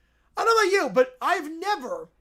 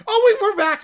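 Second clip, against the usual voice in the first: excited; 7 semitones higher, 10 dB louder than usual. Real, not acted. Excited return to video.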